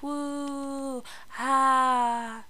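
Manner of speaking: high, drawn-out woo, then a sigh-like ah; celebratory woo. Real, not acted. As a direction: cheer yourself with a long, high woo. Celebrates booking first appointment herself.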